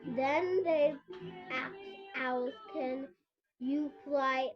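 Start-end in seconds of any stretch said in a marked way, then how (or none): none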